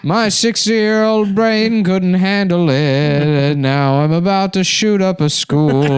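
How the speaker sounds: high, slow, laughing through the voice; giggling crazed old man. Acted.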